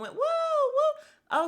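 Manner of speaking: high, loud mocking whoop; mock woo. Jacqueline whooping with her arms out the car window, mocking. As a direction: whoop like a giddy passenger. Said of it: "arms out the window".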